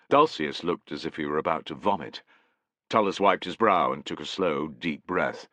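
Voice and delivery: plain reading voice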